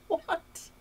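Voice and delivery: a high, squeaking "What?" of disbelief; shocked what. Retold or imitated. To the clerk after hearing two hundred fifteen dollars, shrieking in disbelief. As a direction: squeal it out, floored by the price. Hears the 215 dollar price.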